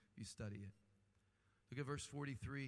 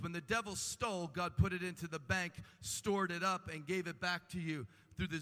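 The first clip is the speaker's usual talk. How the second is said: raised preaching; 5 semitones higher, 6 dB louder than usual. Acted. Preaching restoration.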